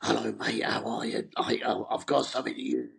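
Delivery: lightly put-on voice of a rock singer ringing up. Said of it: "he's like".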